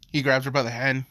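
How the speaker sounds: ordinary delivery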